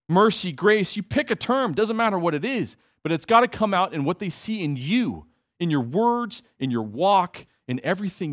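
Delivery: preaching with force. Acted. Preacher urging the church to show their faith, fervently. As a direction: drive the point home to the congregation.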